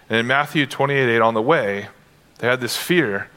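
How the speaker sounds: ordinary talk, nothing marked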